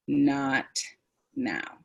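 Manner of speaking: "Not now" stretched, each word apart; emphasized vowels. Shown as a demonstration. Presenter stopping an upset person, drawn-out "Not now". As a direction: hold the vowels in "Not" and "now", firm and calm. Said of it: "I've emphasized the vowel sounds".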